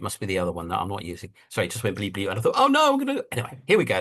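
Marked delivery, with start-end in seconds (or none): 2.6-3.2 high, alarmed yelp; mock panic. Speaker's camera cutting out, high mock alarm. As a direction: flinch at the sudden bleep.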